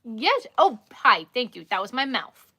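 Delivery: ordinary talk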